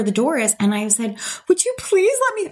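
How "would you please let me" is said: very high, tearful begging; retold crying plea. To the attendant as she starts to cry, high tearful plea. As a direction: squeeze the plea out near tears. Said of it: "I start crying".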